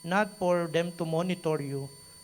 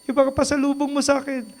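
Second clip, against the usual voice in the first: high, fast mock voice of a mother wanting gifts; a teasing high-pitched mother asking for pasalubong; greedy mother; 9 semitones higher, 5 dB louder, faster than usual. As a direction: play the mom demanding her presents, quick and high. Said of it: "nanay ko".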